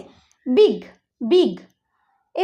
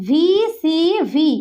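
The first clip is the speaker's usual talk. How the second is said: slow spelling; much slower than usual. Shown as a demonstration. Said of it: "আর long সাউন্ডে".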